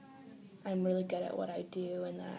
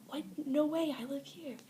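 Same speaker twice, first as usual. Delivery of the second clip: higher, louder "No way, I live here" in mock astonishment; retold disbelief; 8 semitones higher, 4 dB louder than usual. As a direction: replay the moment you couldn't believe it, pitch jumping up. Retold shock, same words repeated.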